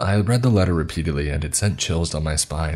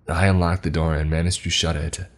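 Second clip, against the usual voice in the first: ordinary delivery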